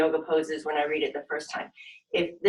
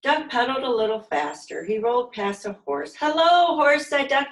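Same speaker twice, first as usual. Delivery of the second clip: put-on lively, expressive read-aloud for little kids; animated children's story narration; storytime reading; 5 semitones higher, 4 dB louder, slower than usual. A narrator's reading. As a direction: read it like a silly picture book to a class. Reading picture book to children.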